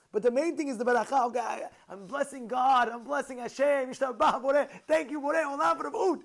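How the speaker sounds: loud, high, jubilant thanksgiving; acted fervent gratitude. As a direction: proclaim the blessing with mounting fervour. Acting out heartfelt blessing of God.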